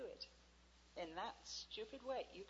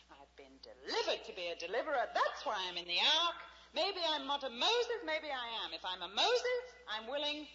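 loud preaching plea; a believer vowing to God aloud; fervent declaration; 5 semitones higher, 10 dB louder than usual. Pretend. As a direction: proclaim it at full force. Voiced prayer to God.

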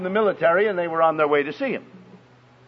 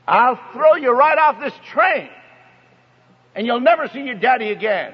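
high, raised threatening voice; retold scream; 5 semitones higher than usual. Woman threatening her little boy on a train, retold scream. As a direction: imitate an angry mother's yelled threat. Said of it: "She screamed at him again".

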